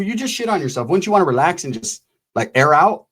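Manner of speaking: usual speaking voice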